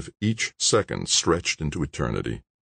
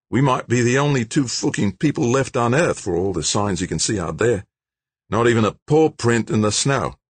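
higher and brighter, a big Aussie bloke talking up the night; young Aussie George; 8 semitones higher than usual. Acted. George's voice in the story.

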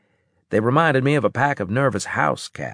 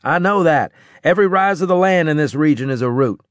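louder, higher, quick snappy reply; irritated; 8 semitones higher, 6 dB louder, faster than usual. Acted. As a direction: brush off the correction.

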